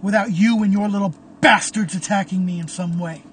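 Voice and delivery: loud, raging yell at a former friend; furious shout. Acted. Caleb yelling at Memphis, furious and loud. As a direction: spit out every word in fury.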